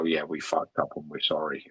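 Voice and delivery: a low, quiet, grudging admission of fault; mumbled apology. Retold or imitated. Company quietly admitting fault to customers, mock apology. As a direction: mutter it like a sheepish company. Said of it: "they don't basically go".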